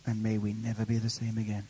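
reverent, subdued plea; prayer. Real, not acted. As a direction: murmur it to God as a closing prayer. Closing prayer.

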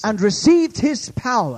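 high, loud proclamation; fervent preacher at full voice; full-force preaching. Real, not acted. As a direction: drive it out to the whole congregation.

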